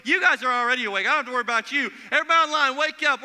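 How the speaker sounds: loud, high call to the crowd; rousing wake-up call. Real, not acted. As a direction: rouse them awake. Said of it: "I want you to wake up".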